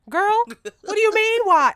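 put-on very high, loud, slow girl what; exasperated retort. Speaker exasperated with the ice cream worker, very high and slow. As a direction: stretch it out in disbelief.

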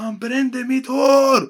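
high, slow, wailing complaint; upset kid. Retold or imitated. Boy telling the teacher his hair got burned, high slow wail. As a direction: whine it out like a wronged kid.

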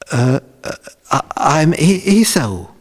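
stammering lie in a high voice; nervous impostor. Acted. Jacob nervously lies to blind father.

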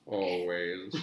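low, slow, solemn Snape; drawn-out, low and hushed. Acted. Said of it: "in my best Snape voice".

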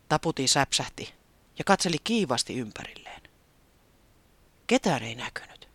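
ordinary delivery

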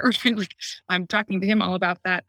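normal talking voice

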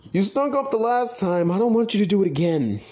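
high mocking boss voice. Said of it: "he was like".

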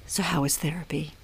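hushed gentle radio voice; soft, careful radio host tone. Retold or imitated. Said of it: "I'd put on my, like, radio voice".

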